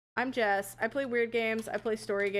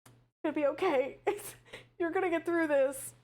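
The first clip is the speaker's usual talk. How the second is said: higher, soothing pep talk to herself; mock self-comfort; 6 semitones higher than usual. Streamer consoles herself after failing the puzzle, mock soothing. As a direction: pat yourself on the back with a coo. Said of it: "I'm devastated".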